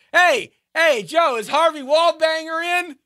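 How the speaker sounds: high, excited call to Joe across the bar. Pretend. A pretend bar customer calls to Joe, high and eager.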